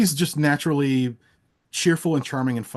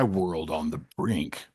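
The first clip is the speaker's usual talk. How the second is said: ominous title read; 7 semitones lower, 4 dB quieter, much slower than usual. Acted. Reading a doom video title.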